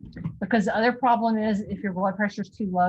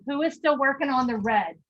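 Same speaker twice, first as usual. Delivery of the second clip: ordinary talk, nothing marked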